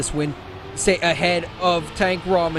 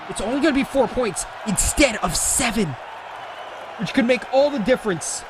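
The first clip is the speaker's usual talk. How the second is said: excited commentary; 6 semitones higher than usual. Real, not acted. Commentator hypes close finish.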